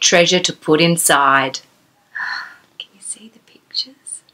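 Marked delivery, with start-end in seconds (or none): 2.8-4.2 soft whispered question to a little listener. Real, not acted. Fairy storyteller showing Gemma the book pictures, whispered. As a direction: whisper it as if sharing a secret.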